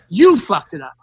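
controlled anger, leaning on YOU; mad retort. Retold or imitated. Comic answers a heckling Trump guy, low mad retort. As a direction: retort with "You" punched, the rest dropping low. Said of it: "I got so mad".

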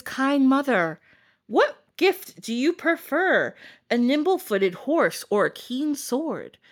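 a hint of a slow, stately prince; formal prince. Prince offers the stepmom a gift, lightly mock-formal and slow.

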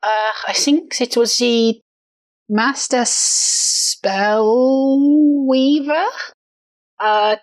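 put-on halting accented character voice, slow on the name; lightly voiced character, unsure of the name; hesitant character. Reporting a dead body to companions, hesitant character voice. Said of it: "and go".

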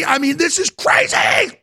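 real angry shout. Real, not acted. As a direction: hammer it out in rage. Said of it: "getting really pissed off".

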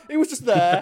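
high, loud laughing disbelief. Real, not acted. Podcaster marvels at Kratos's casual Spartan anecdote, laughing. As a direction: crack up while saying it.

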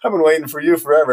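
high, fast mock-exasperated whining at a stalling star; half-laughing, hammed-up 'come on, man' pleading; exasperated plea. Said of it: "And I'm like".

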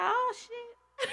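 high, far-off tearful laugh; real tears, laughing; crying laugh. Real, not acted. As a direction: let the tears break through the laugh.

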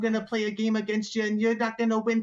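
high, hammed-up gator announcing a challenge; alligator showboating. Alligator stages a fixed challenge, high showy bluster. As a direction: put on a noisy show for the crowd. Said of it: "make a bunch of noise".

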